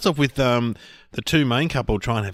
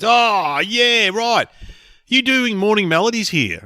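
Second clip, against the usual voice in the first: high, loud dopey bloke voice; imitating a bloke; 12 semitones higher, 6 dB louder, slower than usual. A stranger asking the comedian about morning melodies, high and loud. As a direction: play him slow on the uptake. Guy realises he's a comedian.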